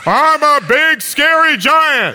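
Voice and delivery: high, slow shouted giant; big scary giant. Pretend. Preacher as the worries facing you, booming mock giant. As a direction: bellow as a pretend giant. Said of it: "I'm gonna be the big, scary giants".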